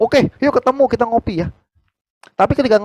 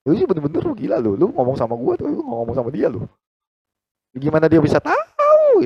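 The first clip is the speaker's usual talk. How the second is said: higher, playful teasing of a close friend; 9 semitones higher than usual. Retold or imitated. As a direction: rib him like an old buddy. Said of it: "kita bisa bercandain gitu kan". (menggoda teman dekat dengan nada tinggi bercanda)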